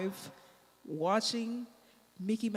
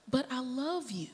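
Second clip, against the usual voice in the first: lightly voiced child; 3 semitones higher than usual. Daughter answers mom's request for five minutes, lightly voiced child. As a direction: plead gently, a hint of a small child.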